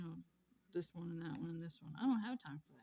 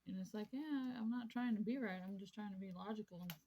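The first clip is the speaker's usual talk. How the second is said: ordinary delivery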